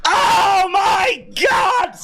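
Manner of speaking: high, slow, crying-out oh my God; tearful shout. Real, not acted. Streamer overwhelmed as Cody wins, tearful shout. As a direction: cry it out in disbelief.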